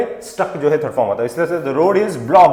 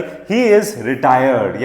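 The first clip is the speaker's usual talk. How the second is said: ordinary delivery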